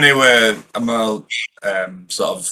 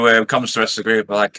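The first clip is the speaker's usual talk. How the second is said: normal talking voice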